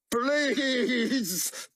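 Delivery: high drawn-out begging cry; terrified scream of please; desperate plea. A narrator's reading. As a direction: plead before the shot. Walter begs before shot.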